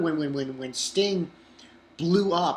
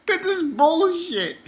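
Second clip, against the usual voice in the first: mock outrage; 12 semitones higher, slower than usual. Real, not acted.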